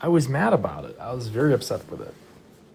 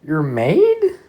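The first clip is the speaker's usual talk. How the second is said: mock indignant; 10 semitones higher, 4 dB louder, slower than usual. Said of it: "I'm like".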